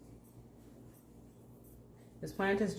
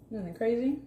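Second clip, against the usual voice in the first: higher, upbeat host delivery with rising end; game show host; 6 semitones higher, 3 dB louder than usual. Acted. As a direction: toss it off like a TV host selling it. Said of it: "Sound like a game show host".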